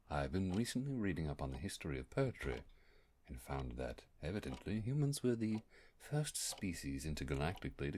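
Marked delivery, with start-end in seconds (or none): none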